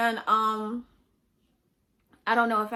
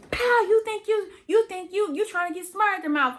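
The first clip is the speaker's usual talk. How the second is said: high, loud accusing dad; angry father yelling; 11 semitones higher, 7 dB louder than usual. Retold or imitated. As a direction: shout the accusation at the child. Father yelling during a whooping.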